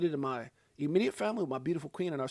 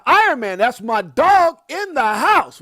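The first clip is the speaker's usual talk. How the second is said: hype shout-out; 8 semitones higher, 12 dB louder, slower than usual. Real, not acted. Hyped shout-out to friend.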